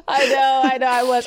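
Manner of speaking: crying with laughter; laughing. Real, not acted. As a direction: laugh till it cracks. Laughing hard at friend.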